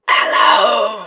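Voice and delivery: hoarse, croaky voice; raspy ruined voice. Acted. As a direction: speak through a burnt throat. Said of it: "Your voice sounds all gone".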